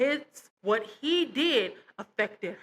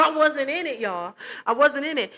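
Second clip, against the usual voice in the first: laughing; 4 semitones higher, 4 dB louder than usual. Real, not acted. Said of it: "laugh on line".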